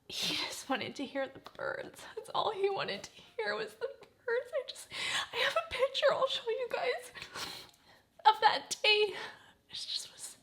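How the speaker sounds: high, choked-up voice, close to sobbing; tearful reminiscing. Real, not acted. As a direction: remember a lost parent through real tears. Tearful memory of late father.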